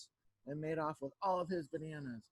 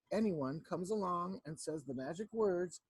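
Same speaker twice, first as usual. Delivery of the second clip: ordinary delivery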